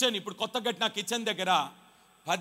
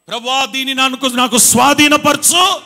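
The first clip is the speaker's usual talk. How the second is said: loud prayer; 4 semitones higher, 13 dB louder than usual. Retold or imitated. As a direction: cry out the prayer, drawing it out. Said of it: "అని ప్రార్థన చేసినాను".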